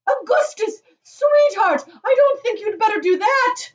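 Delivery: very high, loud motherly warning; shouting. A narrator's reading. Mother warning her son, shouting. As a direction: call out in an alarmed high voice. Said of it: "shouted Missus Gloop".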